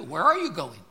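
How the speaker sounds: usual speaking voice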